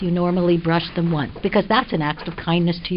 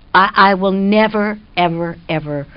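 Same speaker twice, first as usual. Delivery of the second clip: ordinary talk, nothing marked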